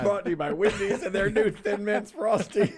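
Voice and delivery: high, loud plug through laughter; laughing ad read. Pretend. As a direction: giggle through the sponsor line.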